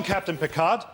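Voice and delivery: ordinary talk, nothing marked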